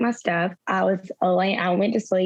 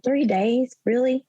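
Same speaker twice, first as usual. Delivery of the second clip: higher, incredulous mock outrage at grandfather; retold indignation; 5 semitones higher than usual. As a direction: replay being mad at him, pitched up in disbelief. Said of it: "I was kinda mad at him".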